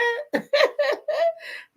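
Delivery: shrieking laugh; high squealing burst of laughter; real high-pitched laugh. Real, not acted. Laughing at the name.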